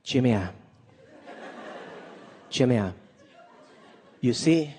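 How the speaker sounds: lightly imitating his Chinese mother's accent, low; a hint of a scolding Chinese mom; mild mock accent of a mother consoling her son; mom's Chinese accent. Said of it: "She was like".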